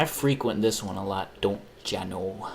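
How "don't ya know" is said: put-on a touch of a slow Midwest accent. Tacking on the Midwest catchphrase, slow mild accent. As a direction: drawl "don't ya know" slowly. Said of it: "the Midwest accent".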